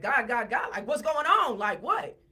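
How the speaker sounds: loud, high, frantic demand for answers; retold yelling. Yelling at Terry on finding her on her knees, retold loud yell. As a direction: re-enact yelling in alarm, pitch jumping up. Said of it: "So I'm yelling at Terry".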